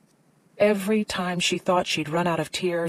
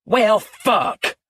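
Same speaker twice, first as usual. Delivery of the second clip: angry outburst; 4 semitones higher, 5 dB louder than usual. A narrator's reading. Phil upset about cat.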